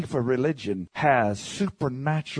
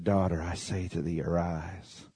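hushed command; 7 semitones lower, 8 dB quieter than usual. Acted. Jesus's quiet command, hushed.

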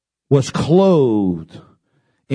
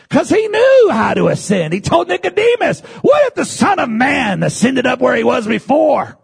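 preaching shout; 9 semitones higher, 3 dB louder than usual. Acted. Loud preaching burst.

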